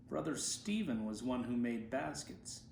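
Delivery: plain reading voice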